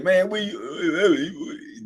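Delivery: soft, drawn-out take on a buddy's voice; imitating slow-talking friend. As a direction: stretch it out slowly, imitating him. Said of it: "He got a real deep, slow voice".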